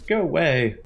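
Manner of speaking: sharp loud go away through the door; a woman shouting at her rival to leave; shouted go away. Acted. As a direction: yell it at the door to be left alone.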